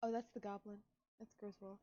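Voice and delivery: usual speaking voice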